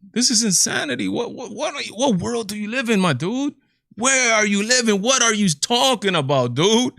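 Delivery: can't believe what he's hearing; exasperated disbelief. Real, not acted. Reacting to the ESPN pundit, high exasperated disbelief.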